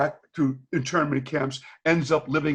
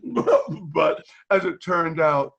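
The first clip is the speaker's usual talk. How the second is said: ordinary talk, nothing marked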